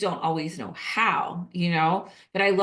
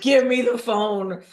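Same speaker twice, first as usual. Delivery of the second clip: slow, louder, firm request for the phone; take-charge demand; 4 semitones higher, 5 dB louder, slower than usual. Retold or imitated. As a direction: claim the phone like the family expert. Stepping in for crying niece.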